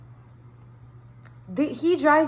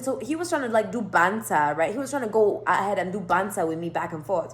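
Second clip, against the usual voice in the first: usual speaking voice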